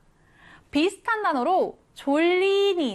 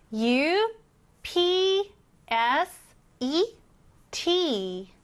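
upset spelled letter by letter, slow; spelling aloud; 3 semitones higher, much slower than usual. Shown as a demonstration. Teacher spelling upset letter by letter slowly. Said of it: "이 단어를 써볼게요".

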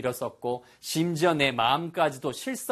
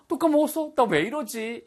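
much higher, dismayed at yourself; dismayed self-reproach; 10 semitones higher than usual. Shown as a demonstration. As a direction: scold yourself in shock. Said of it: "이런 식의 느낌인 거죠". (자신에게 어이없어하는 높은 목소리)

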